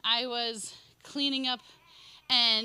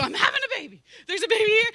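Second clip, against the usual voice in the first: much higher and louder, laughing with delight; joyful announcement; 11 semitones higher, 9 dB louder, faster than usual. Retold or imitated. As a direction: shout the happy news to everyone. Telling strangers she's pregnant.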